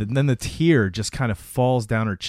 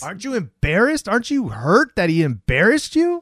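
high-pitched incredulous questions; retold indignant prodding; angry needling; 9 semitones higher, 3 dB louder than usual. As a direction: push for a reaction, pitched high. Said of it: "Grace is super angry".

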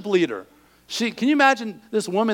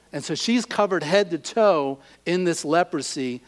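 usual speaking voice

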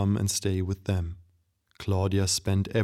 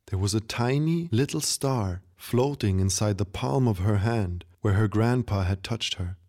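plain reading voice

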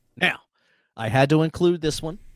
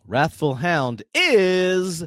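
dramatic reveal. Acted. Quiz host dramatic reveal.

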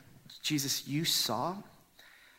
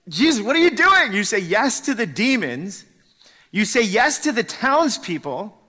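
much higher, much louder exasperated protest; loud exasperated complaint; frustrated outcry; 9 semitones higher, 11 dB louder than usual. Acted. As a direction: cry out in disbelief. Frustrated outburst at Jesus.

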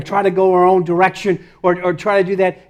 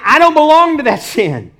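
high, loud preacher's declaration; raised-voice defiant declaration; emphatic declaration; 8 semitones higher, 5 dB louder than usual. Acted. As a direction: proclaim it forcefully to the congregation.

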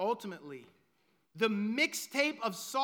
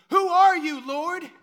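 loud question; 5 semitones higher, 10 dB louder than usual. Acted. Sermon climax, Saul's question again.

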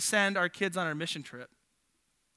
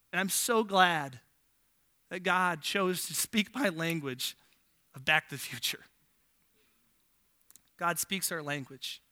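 soft sincere preaching; 4 semitones lower, 5 dB quieter than usual. Real, not acted. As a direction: bring them close, gently. Preaching softly, slowing.

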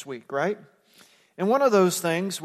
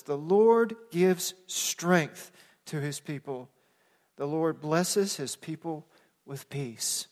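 slow, measured verse, softening on "peace"; slow scripture reading; slower than usual. Real, not acted. Pastor sharing a psalm with the congregation, slow and measured. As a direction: read it slowly, phrase by phrase, settling down at the end. Reads the verse slowly first time.

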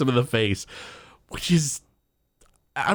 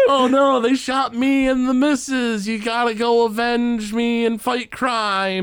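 overacted death scene; 10 semitones higher than usual. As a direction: overplay a dying father's last words. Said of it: "pretend to die or something".

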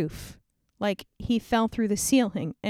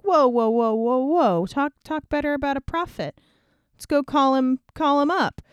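louder, higher, hands-up protest; excited whoa; 5 semitones higher, 4 dB louder than usual. Acted. Jehoshaphat cuts off Ahab's complaint, loud and excited. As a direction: stop him short, eager.

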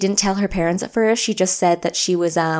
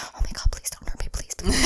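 mock scared whisper; 6 dB quieter, faster than usual. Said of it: "talking about, like, being kidnapped".

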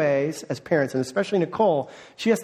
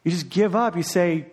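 ordinary delivery

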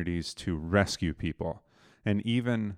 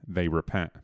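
normal talking voice

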